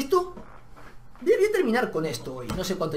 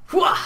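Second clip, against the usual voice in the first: loud exhale; 7 dB louder than usual. Real, not acted. Worked-up exclamation.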